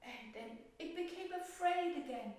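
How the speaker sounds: put-on gentle storytelling to children; story narration. A narrator's reading. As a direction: read it like a fairy tale for little ones. Teacher tells the violet story.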